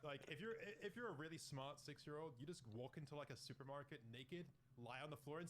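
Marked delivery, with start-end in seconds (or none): none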